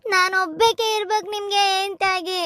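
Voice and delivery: normal talking voice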